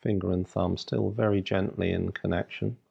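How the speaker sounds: ordinary talk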